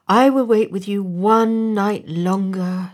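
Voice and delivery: lightly voiced eager helper; storybook swallow. A narrator's reading. Swallow agrees to stay one more night.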